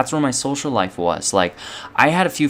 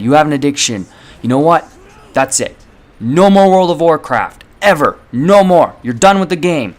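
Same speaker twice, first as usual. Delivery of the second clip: angry parents; 4 semitones higher, 5 dB louder than usual. Retold or imitated. Parents angrily banning the game.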